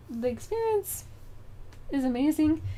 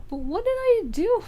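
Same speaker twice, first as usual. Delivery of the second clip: high, louder wounded "what did I do"; incredulous, high and loud; baffled protest; 11 semitones higher, 5 dB louder than usual. Retold or imitated. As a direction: protest in mock disbelief, pitched up. Retold baffled reply to accusers.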